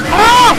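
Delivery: high sudden yelp; startled scream. Real, not acted. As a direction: yelp in fright. Screaming at video.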